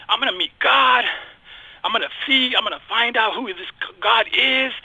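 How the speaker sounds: retold eager excitement, pitched high; excited anticipation. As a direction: relive your hopeful excitement, voice lifted. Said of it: "I was so excited".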